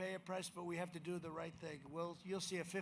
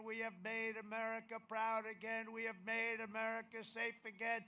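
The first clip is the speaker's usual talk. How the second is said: rally speech; 5 semitones higher, 6 dB louder than usual. Acted. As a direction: hammer each line to the crowd.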